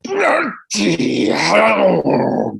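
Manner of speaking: huge drawn-out booming bellow; voice of God. Retold or imitated. Angry guest in the next room, booming slow roar. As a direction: boom it out like a furious god. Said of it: "like, a voice of God".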